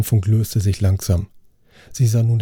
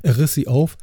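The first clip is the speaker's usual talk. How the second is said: usual speaking voice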